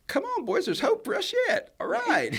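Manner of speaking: high, thrilled cry to the band, laughing at the end; excited cheer. Retold or imitated. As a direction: cheer the boys on, full of hope. Excited reaction to Brooks and Dunn.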